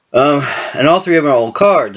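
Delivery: normal talking voice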